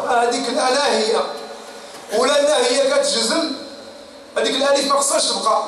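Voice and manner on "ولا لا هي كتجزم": usual speaking voice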